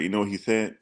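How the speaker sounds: ordinary talk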